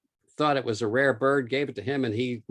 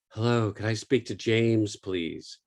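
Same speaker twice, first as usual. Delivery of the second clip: put-on breathless young female caller. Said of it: "breathless young females going".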